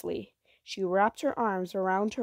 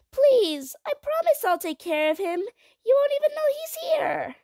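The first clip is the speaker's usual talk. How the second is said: put-on high, loud, pleading child voice; high-pitched urgent plea; begging child; 6 dB louder than usual. A narrator's reading. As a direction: beg desperately like a little girl. Child begs parents.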